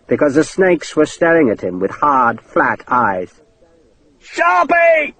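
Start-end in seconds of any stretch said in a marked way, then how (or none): 4.2-5.1 much higher drawn-out call; panicked shout. Acted. Hakeswill alone with the snakes calls for Sharp, panicked shout. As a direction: call for help in terror. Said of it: "he shouted".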